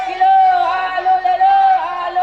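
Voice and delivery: loud sing-song hawker cry; melodic vegetable vendor call. Acted. Vendor calling out potatoes. (सब्ज़ीवाले की ऊँची पुकार)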